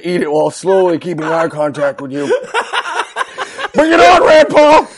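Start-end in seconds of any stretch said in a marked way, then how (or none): 3.7-4.9 higher, louder taunting yell; mock challenge yell. Christie impression yells a mock challenge at Rand Paul. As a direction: hurl it like a fight challenge.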